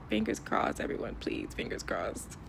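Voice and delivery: soft, nearly whispered hope; hushed plea. Real, not acted. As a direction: sign off quietly, hoping hard. Hushed hopeful sign-off before embassy answer.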